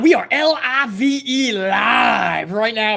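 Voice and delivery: slow, drawn out announcer call; announcer hype. Acted. Telling the stream it's live, drawn-out announcer call. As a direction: spell out live like a ring announcer.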